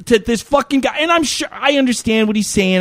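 ordinary delivery

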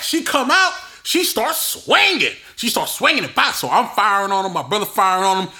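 ordinary delivery

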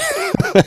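a short high laugh, touched; moved laugh. Real, not acted. Host laughing after being moved by his guest.

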